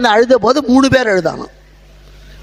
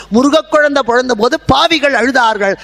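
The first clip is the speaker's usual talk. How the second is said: ordinary talk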